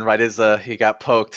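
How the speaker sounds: ordinary talk, nothing marked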